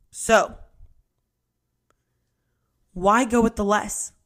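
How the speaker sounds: ordinary talk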